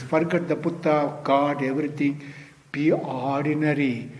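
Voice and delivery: usual speaking voice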